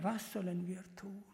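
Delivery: whispered, pleading question; whispered desperate question; hushed plea. Acted. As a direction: breathe it out in awe. Sudden hushed plea of listeners.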